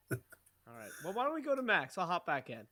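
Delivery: faint, distant voice; talking from across the room; far from mic. Real, not acted. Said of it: "Far away, brother".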